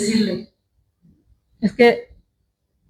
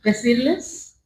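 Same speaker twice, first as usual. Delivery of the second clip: louder preaching exhortation; 5 dB louder than usual. Acted. Preacher urging congregation to forgive, louder. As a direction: urge the church to forgive.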